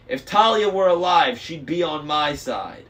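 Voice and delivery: heated retort. A narrator's reading. Luke arguing with Annabeth.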